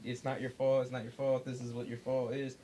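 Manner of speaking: ordinary talk, nothing marked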